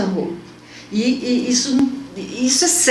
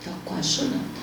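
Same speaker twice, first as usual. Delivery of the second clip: hushed whisper, almost breaking; 10 dB quieter than usual. Real, not acted. To the host, reliving the mirror moment, whispering. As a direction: let it fall to a whisper as the memory hits. Repeats that she nearly cried.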